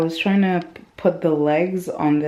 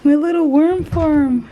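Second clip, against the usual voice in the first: high, gushing delight; excited; 12 semitones higher, 5 dB louder than usual. Real, not acted. New owner greeting a long-awaited worm farm, high excited voice. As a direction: let the excitement lift your voice right up. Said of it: "So excited".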